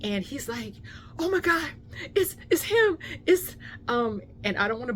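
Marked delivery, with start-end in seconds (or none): 1.2-3.5 high, loud, stammering starstruck excitement; Finn beside himself. Retold or imitated. Friend recognizes the YouTuber in the SUV, imitated giddy excitement. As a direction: imitate a fan too thrilled to finish. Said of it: "he was just beside himself".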